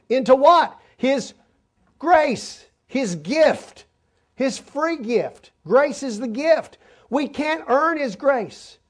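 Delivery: high, slow emphatic preaching; slow rising pulpit fervor; impassioned preaching. Acted. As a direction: lift each word about grace. Preaching climax on grace.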